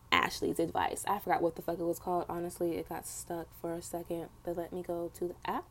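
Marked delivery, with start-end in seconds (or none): none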